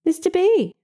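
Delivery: sugary high greeting; sweet. A narrator's reading. Narrator greets Mister Bell sweetly. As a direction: sweeten it to disarm him. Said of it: "in my sweetest voice".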